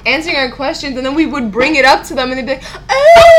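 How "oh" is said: high, loud drawn-out "oh!"; mock loud "oh". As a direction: belt out a long, exaggerated "oh". Retold over-the-top reaction to questions.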